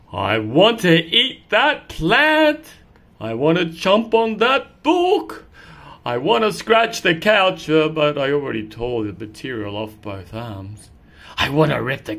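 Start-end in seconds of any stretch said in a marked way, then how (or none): 0.1-10.0 slow, a bit higher wish list; lightly voiced naughty cat; mischievous cat.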